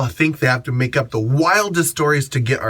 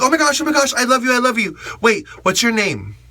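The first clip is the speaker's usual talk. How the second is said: squealing, much higher fan gush; mock starstruck fan, way up high; 9 semitones higher, faster than usual. Imitating gushing fan.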